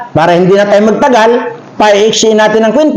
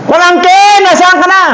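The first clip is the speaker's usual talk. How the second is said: calling out; 10 semitones higher than usual. Acted. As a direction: shout for the lost nephew. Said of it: "sigaw siya ng pamangkin".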